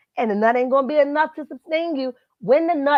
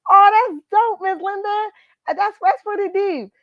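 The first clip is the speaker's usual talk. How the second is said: high, loud and bright with delight; real thrill, voice jumping up high and loud; 10 semitones higher, 5 dB louder than usual. Real, not acted. Greeting longtime viewer Miss Linda from Texas, genuinely excited and much higher.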